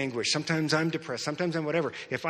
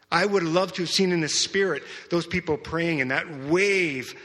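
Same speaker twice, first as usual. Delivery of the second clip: preaching; 3 semitones higher, 4 dB louder than usual. Acted. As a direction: proclaim the coming harvest over the church. Prophetic declaration to the church.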